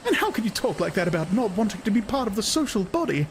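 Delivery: high, shocked woman's protest; a woman defends the social body; Lenina's voice. A narrator's reading. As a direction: argue the creed with dismay. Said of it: "Lenina arguing".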